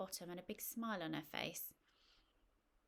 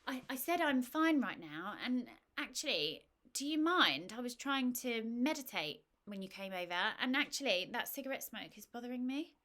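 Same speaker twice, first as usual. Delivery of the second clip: huffy; 5 semitones higher, 7 dB louder than usual. Acted. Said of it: "Mandy sort of huffed and said".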